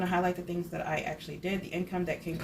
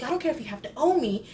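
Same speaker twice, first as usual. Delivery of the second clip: high, louder scolding, imitating the eyebrow lady; mock angry scolding; 7 semitones higher, 6 dB louder, faster than usual. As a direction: scold her like a cross beautician, hammed up. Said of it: "she gets so mad".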